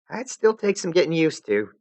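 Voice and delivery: put-on bright high girl voice; friendly young girl; Jade voice.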